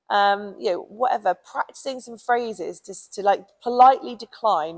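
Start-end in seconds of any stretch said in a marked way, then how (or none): none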